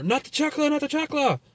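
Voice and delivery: high-pitched Mexican mom scolding from next door; mom's scolding cry. Retold or imitated. Neighbor mom scolding her son, high Spanish-accented cry. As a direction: shriek it like an angry mom heard through the wall.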